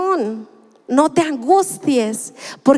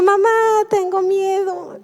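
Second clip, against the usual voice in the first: put-on mildly whiny, higher-pitched child's plea; imitated crying child; 6 semitones higher than usual. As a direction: lightly act out a scared toddler. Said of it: "Y ella seguía llorando". (imitando levemente a una niña que llora)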